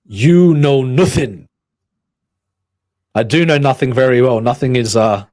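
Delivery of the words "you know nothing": mock ominous. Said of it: "Carol's Cookie says".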